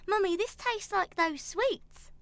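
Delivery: put-on high, slow childish squeak; little girl voice. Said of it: "but that voice is very scary".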